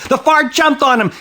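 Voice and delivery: high-pitched burst of storytelling alarm; startling narrator outburst; sudden excitement. A narrator's reading. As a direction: pounce on it, loud and high.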